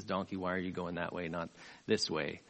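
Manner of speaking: low, soft aside to a donkey; understated, low-pitched retold complaint; quiet complaint. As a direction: grumble it quietly, dropped well down. Voices rider talking to his donkey.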